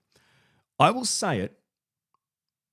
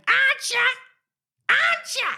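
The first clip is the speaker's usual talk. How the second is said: piercing falsetto repetition; put-on voice. Shown as a demonstration. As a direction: echo the phrase in a very high squawk. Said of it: "that's just one voice".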